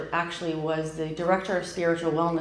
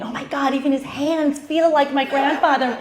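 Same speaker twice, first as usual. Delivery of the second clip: excited gush; 8 semitones higher, 6 dB louder than usual. Retold or imitated. Excited call to husband.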